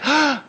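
short high gasp of realisation; sudden gasp. Acted. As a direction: gasp as if it suddenly dawns on you. Convert's sudden realisation, acted.

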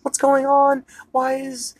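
put-on high-pitched confused kid-like questioning; confused Charlie. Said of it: "But Charlie is like".

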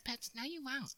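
wife voice. Retold or imitated. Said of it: "My wife doesn't sound like a man".

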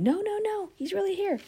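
put-on insistent girl. Rhoda pleading with the group, high and urgent. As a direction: refuse to be doubted, pitch up high.

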